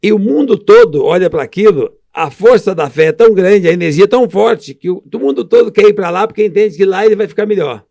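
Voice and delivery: rising to a fervent, loud peak; passionate build. Real, not acted. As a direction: preach the power of belief.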